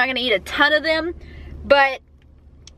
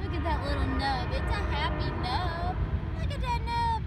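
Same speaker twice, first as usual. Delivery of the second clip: high cooing pet voice; 5 semitones higher, 10 dB quieter than usual. Real, not acted. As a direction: coo over the little nub. Cooing at horse's nub.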